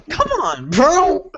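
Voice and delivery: squeaky falsetto whine; high whiny voice. Acted. Exasperated mock plea.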